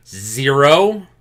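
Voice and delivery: loud, rising incredulous question; mock disbelief. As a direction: repeat it as if you can't believe it. Mock shock at reported temperature.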